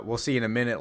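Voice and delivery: ordinary talk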